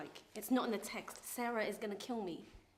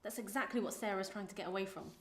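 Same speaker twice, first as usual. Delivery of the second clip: ordinary delivery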